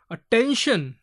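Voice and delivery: "attention" with ten lifted; stress on ten. Shown as a demonstration. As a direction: lean firmly on the middle of "attention". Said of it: "So here stress is given".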